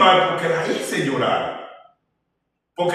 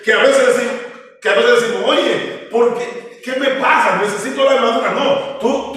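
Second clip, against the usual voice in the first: higher, emphatic sermon voice with slowed key words; preaching; 4 semitones higher than usual. Acted. Preacher tells the congregation about the armor of Christ, preaching. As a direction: drive the point home to the church.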